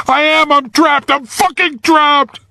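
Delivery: put-on high, frantic boy's voice; trapped boy losing control, panicking; panic. A narrator's reading. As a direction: spiral into panic. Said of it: "Now there was panic".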